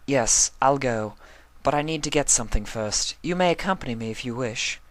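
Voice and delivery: high, refined mare, gathering herself; lightly voiced Rarity. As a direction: answer with polite resolve.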